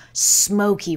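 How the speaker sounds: ordinary delivery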